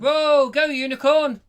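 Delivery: high, loud whoop; excited cheer. Real, not acted. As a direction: cheer the unicorn on. Cheering the story.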